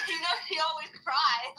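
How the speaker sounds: high, slow, laughing tease; teasing laugh. Real, not acted. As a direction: laugh at her while saying it.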